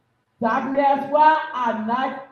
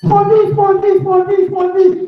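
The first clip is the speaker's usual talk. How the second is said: loud, higher repeated shout; shouted answer; 6 semitones higher, 9 dB louder than usual. Real, not acted. Contestant racing to answer the quizmaster, shouting. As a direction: yell the answer to beat the others.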